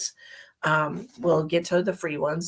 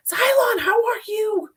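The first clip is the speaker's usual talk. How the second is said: high, loud call to a viewer; excited greeting; 4 dB louder than usual. Real, not acted. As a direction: cry out a delighted hello.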